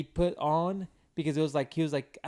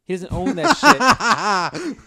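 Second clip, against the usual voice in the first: loud, slow, higher laughing delivery; 4 semitones higher, 11 dB louder, much slower than usual. Real, not acted. Friend mocking the rented cars, real loud laughing. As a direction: burst into laughter while saying it.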